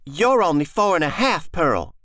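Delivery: put-on a boy pulling rank; boy's voice. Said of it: "he said".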